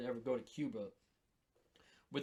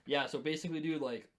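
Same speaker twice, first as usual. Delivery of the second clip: ordinary delivery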